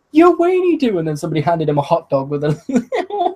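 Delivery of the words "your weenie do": squeaky punchline. Retold or imitated. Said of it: "it's like".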